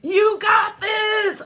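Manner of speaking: rising to a high, loud cheer; climbing cheer that ends in a shout; cheerleader hype. Retold or imitated. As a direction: pump the kids up, building. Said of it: "I was their best cheerleader".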